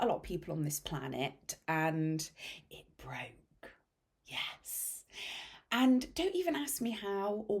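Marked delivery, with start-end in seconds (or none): 2.7-5.0 hushed deadpan admission; soft whispered confession; whispered aside. Real, not acted. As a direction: drop to a whisper, deflated.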